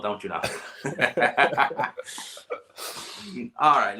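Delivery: ordinary talk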